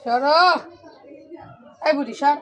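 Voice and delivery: mother telling her daughter to move; shooing off. Real, not acted. Shooing child off the blanket.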